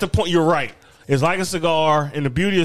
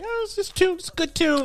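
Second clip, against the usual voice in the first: much higher mock casual voice; 3 dB quieter than usual. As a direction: squeak it out like a dismissive fan. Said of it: "They're like".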